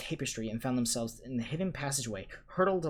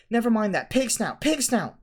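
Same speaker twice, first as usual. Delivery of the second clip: loud, high breathless urgency; out of breath from the run, urgent; panted password; 11 semitones higher, 9 dB louder than usual. A narrator's reading. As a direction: gasp the password out fast. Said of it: "panted Harry".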